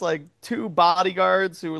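usual speaking voice

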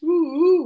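high, airy owl hoots; owl hoot imitation. Game master guessing what sound an owl makes, hooting. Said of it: "what a sound an owl makes".

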